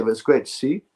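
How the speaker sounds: usual speaking voice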